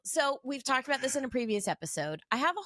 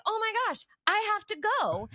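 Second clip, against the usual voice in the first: high-pitched, urgent retold exclamation; reenacted excitement; 9 semitones higher, 3 dB louder than usual. Wife reenacting news of friend's show, excited. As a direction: replay your eager reaction, pitched up. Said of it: "let me reenact what happened".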